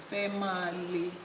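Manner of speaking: "Fẹ́ máa le" for female, three syllables, feh-MAH-leh; misread sign. Acted. Yoruba man reading bathroom sign, misreading female as "Fẹ́ máa le". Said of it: "He's reading female as".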